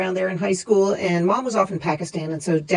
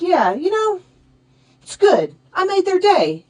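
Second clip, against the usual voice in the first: higher, pleased voice of dad; dad cheered up; 12 semitones higher, 5 dB louder than usual. Retold or imitated. Dad answering his daughter, pleased and higher. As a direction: brighten up, proud of making their day. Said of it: "he said".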